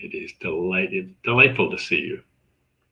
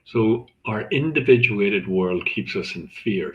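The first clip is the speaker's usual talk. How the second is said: usual speaking voice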